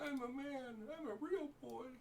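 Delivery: put-on very high puppet-boy voice; mock real boy. Game master echoing a player's joke, high mock boy voice. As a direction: joke in a squeaky little boy's pitch.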